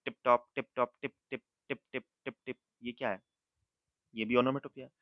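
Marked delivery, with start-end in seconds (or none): 0.0-2.6 teacher imitating rain on the roof; imitating raindrops. Shown as a demonstration. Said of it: "उनकी sound कैसी आती है?".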